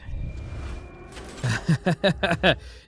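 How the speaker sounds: a short airy laugh between words. Real, not acted. Caster laughing at a team burned at the end. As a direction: laugh at the last-second loss.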